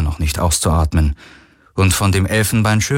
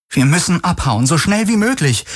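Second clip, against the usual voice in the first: high-pitched, pressing plea; urgent; 9 semitones higher than usual. A narrator's reading. Kirai urges the captain to flee, urgent. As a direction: push the captain to flee, voice climbing. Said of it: "drängte Kirai".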